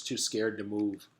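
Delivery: usual speaking voice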